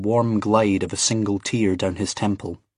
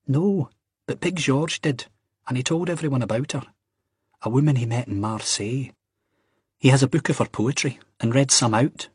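high-pitched Scottish storyteller friend; lifted, eager young Scot; Ian's gossiping voice; 5 semitones higher than usual. Acted. Acts Ian in story.

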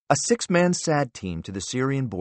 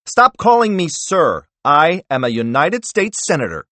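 angry cut-off; 8 semitones higher, 7 dB louder than usual. Acted. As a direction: slam each word down. Wells cuts director off angrily.

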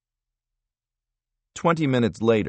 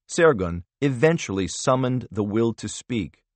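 ordinary delivery, nothing marked

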